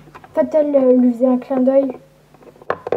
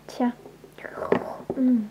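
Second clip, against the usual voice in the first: ordinary talk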